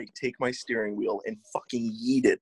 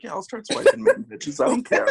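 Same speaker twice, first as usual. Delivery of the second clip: higher, louder, breezy don't-care brag; careless bravado; 13 semitones higher, 5 dB louder than usual. Retold or imitated. Telling friends how he decided to swipe on Maine girls, with careless bravado. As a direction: toss it off like you couldn't care less. Retelling his own careless thought.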